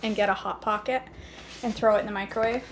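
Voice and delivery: ordinary talk, nothing marked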